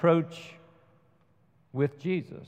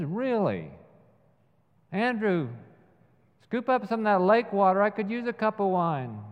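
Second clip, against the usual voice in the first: mocking scoff; 7 semitones higher than usual. Simon mocking John's miracle claim.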